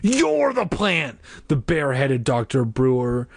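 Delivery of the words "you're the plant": accusing. Acted.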